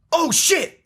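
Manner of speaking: sudden high yelp of surprise; startled cry. Retold or imitated. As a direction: blurt it out in shock. Milton cursing after hitting something.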